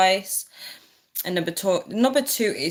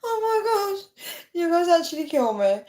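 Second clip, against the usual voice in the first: high, teary laughing; crying with laughter; 11 semitones higher than usual. Real, not acted. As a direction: overcome by laughter.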